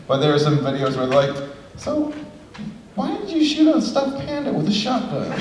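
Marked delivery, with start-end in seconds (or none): 1.8-5.3 pitched way up, amused question; high laughing coworkers. Retold or imitated. Coworkers asking about the panda video, high and laughing. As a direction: ask it with baffled giggling.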